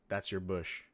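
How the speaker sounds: ordinary delivery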